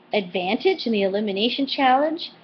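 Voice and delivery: ordinary talk, nothing marked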